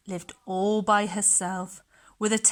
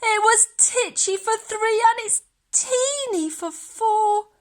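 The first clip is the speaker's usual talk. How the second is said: put-on high, loud old lady plea; old lady crying out; 11 semitones higher, 4 dB louder than usual. A narrator's reading. Old lady pleading as the pig raids the larder, loud and high. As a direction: cry out to make it stop. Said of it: "The little old lady cried".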